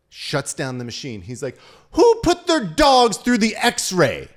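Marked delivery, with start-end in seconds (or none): 1.9-4.3 slow, high, very loud mock outrage; angry agent's yell. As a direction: bellow it like a furious security agent. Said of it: "that T S A agent was so angry".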